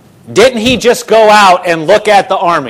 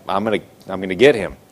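low, soft, quick muttering; muttered threat; 10 semitones lower, 7 dB quieter, faster than usual. Acted. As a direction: mutter it fast under your breath, plotting revenge. Voicing the king vowing revenge.

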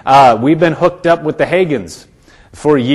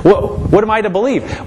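higher, puzzled voice of someone torn between opinions; confused believer; 6 semitones higher than usual. Retold or imitated. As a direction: play the confused listener, a bit higher. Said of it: "you'll get so con".

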